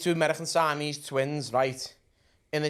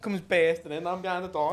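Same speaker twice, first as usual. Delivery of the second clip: ordinary talk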